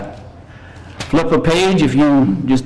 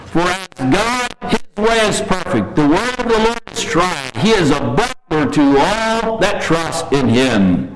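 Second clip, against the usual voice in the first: scripture reading; 5 semitones higher than usual. Acted. Preacher reading the psalm to his congregation, reverent and higher. Reads Psalm eighteen verses.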